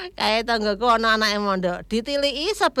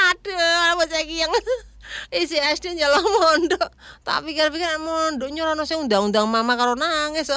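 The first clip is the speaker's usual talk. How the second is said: laughing; 6 semitones higher than usual. Real, not acted. Talking on through laughing fit.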